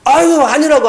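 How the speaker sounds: 아유 thrown out high and loud; yelled denial. Retold or imitated. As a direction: shout him off, exasperated. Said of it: "소리를 막 질렀던 것 같아요".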